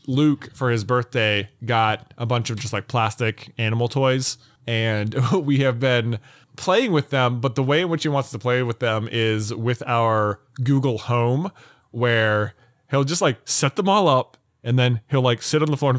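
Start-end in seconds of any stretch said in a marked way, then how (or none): none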